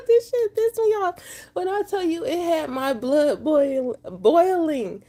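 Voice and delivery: worked up and tearful, high-pitched; real, close to crying. Real, not acted. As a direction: voice jumping high with upset. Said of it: "if y'all see me crying".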